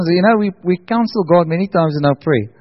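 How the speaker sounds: ordinary delivery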